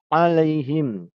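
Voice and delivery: Alaihim slow and loud; correct pronunciation. Shown as a demonstration. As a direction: say Alaihim slowly and clearly. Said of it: "jangan alaihim".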